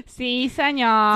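slow, drawn-out mock Mexican accent; imitated Mexican accent. Player teasing partner's habit, mock Mexican accent. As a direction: mimic a hammy Mexican accent, stretching it. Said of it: "because you always went".

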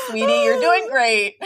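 a sing-song tease between laughs; laughing mock comfort. Friend teasingly praising the tired mom, laughing.

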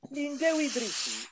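put-on high, slow Welsh accent; mock Welsh accent, drawn out. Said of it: "Do your Welsh accent again".

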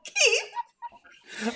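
laughing helplessly; can't stop laughing. Real, not acted. Cracking up at co-host.

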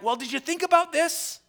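crowd shouting back. Retold or imitated. Imagined farmers calling out to the prophet, loud mock shout. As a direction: call it out like a heckling farmer. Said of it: "people would be shouting back".

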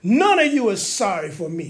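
slow, wailing indignant rant; aggrieved king railing at his people; going off. Acted. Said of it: "he went off on his own people".

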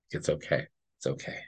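soft, low, reassuring murmur; soothing. Retold or imitated. Psychic comforting the fearful spirit, soft soothing murmur. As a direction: hush it like comforting a scared child.

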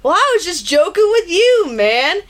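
lightly voiced laid-back stoner; Tommy Chong. Rusty Nail taunting Fuller, lightly voiced stoner. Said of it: "sounded like Tommy Chong".

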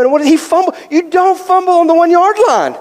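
high, incredulous fan outburst; retold sports-fan outrage; exasperated disbelief. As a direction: yell at the TV in disbelief.

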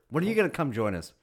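ordinary talk

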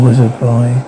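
ordinary delivery, nothing marked

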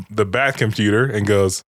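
ordinary talk, nothing marked

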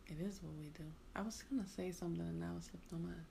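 soft unvoiced whisper. Real, not acted. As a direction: breathe it as a whisper. Whispering to partner.

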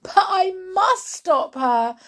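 high, anguished wail; distressed woman's high-pitched protest. Acted. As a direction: wail it in a panic. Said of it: "Missus Cranky wailed".

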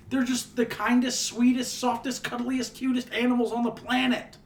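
high adoring gush; gushing over quokkas. Real, not acted. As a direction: gush over the cutest animal.

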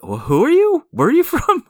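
surprised. Retold or imitated. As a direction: blurt it out, baffled and startled. Voicing a baffled Doctor Strange.

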